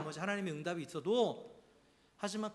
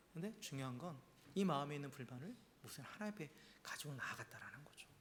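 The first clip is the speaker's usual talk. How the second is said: hushed whisper; 3 semitones lower, 9 dB quieter than usual. Real, not acted. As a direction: drop to a near whisper for emphasis. Preacher drops to whisper. (속삭이듯 조용히)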